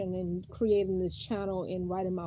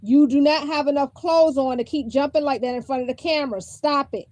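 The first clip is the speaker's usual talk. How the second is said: scolding; 6 semitones higher, 11 dB louder than usual. Real, not acted. Mother scolding child off-camera.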